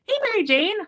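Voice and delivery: high, loud sing-song hello; sing-song greeting. Pretend. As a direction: call out in a bright singsong. Greeting in a put-on voice.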